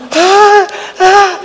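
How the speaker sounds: high mock swooning sigh; hammed-up swoon; swooning moan. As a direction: imitate a fan swooning at a star. Fans swooning.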